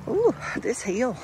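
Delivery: winded from the uphill walk; puffing between words; out of breath. Real, not acted. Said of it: "I know y'all hear me breathing".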